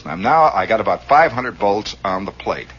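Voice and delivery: ordinary talk, nothing marked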